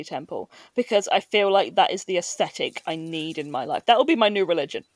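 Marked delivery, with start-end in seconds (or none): none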